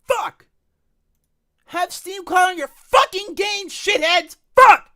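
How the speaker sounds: high, loud screaming at the devs; furious shout. Real, not acted. Streamer screaming at the game devs, furious. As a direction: yell it at the developers. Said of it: "This is the one time where I'm seething".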